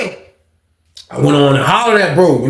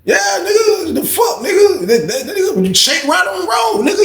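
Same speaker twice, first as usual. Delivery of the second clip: mocking shout. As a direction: holler at him, half laughing. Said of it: "I'm like".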